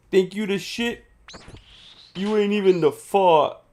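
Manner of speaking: mock New York accent taunt. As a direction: spit the line like a New York hard case. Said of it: "The New York accent".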